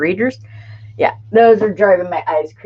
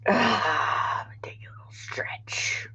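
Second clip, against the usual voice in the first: breathy whisper while stretching, slowed right down; sigh, then whisper the words through the stretch; soft drawn-out whisper mid-stretch; 11 dB quieter, much slower than usual. Real, not acted. Stretching during live chat.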